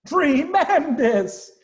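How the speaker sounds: high, loud, drawn-out exclamation; shout. Acted. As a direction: bellow it with joy. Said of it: "shouted Badger".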